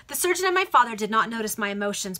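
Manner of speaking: plain reading voice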